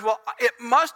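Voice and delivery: high, emphatic reasoning turned on oneself; anguished self-blame. Acted. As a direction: argue it out in frustrated distress. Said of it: "so he reverts and he goes".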